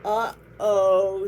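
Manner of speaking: loud dismayed uh-oh. Acted. Panda cub realising the sneeze broke things, loud uh-oh. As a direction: realise you broke something.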